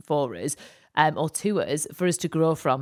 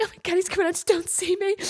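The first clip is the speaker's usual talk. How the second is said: panicked hiding; 12 semitones higher, faster than usual. Retold or imitated.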